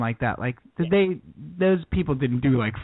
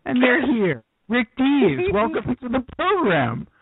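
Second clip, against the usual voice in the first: high, bright mock welcome; excited radio host; 7 semitones higher than usual. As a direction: greet a surprise studio guest. Mock greets surprise studio guest.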